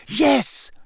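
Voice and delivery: high, heated burst. A narrator's reading. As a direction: snap it out with indignation. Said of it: "in a heated voice".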